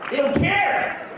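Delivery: strut in, shouting; hammed-up bravado; mock swagger shout. Swaggering entry.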